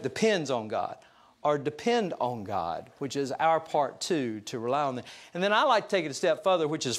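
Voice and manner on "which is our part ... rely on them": ordinary talk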